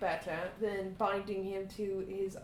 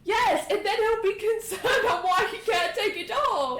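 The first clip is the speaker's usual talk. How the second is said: higher and louder, exasperated; exasperated loud protest; 10 semitones higher, 7 dB louder than usual. Real, not acted. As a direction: push the objection hard, half laughing. Arguing about the spell.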